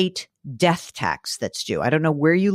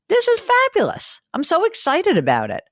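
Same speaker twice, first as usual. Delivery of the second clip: excited; 14 semitones higher than usual. Real, not acted. Host gushing about new show.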